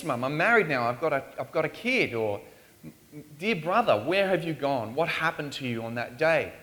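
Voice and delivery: usual speaking voice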